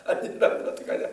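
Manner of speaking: retold worry dissolving into giggles; imitated helpless laughing; laughing while talking. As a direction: crack up halfway through the words, voice squeaking away. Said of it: "본인도 웃더라고요".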